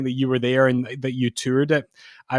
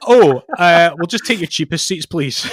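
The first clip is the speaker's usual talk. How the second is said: flustered; 5 semitones higher, 10 dB louder than usual. Retold or imitated. Stepfather backpedals at price.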